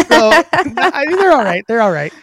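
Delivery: talking through laughter; laughing. Real, not acted. Laughing after the meows.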